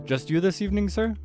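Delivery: high-pitched, lifted customer-service voice; polite server question. Acted. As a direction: ask politely as a waiter, pitched well up.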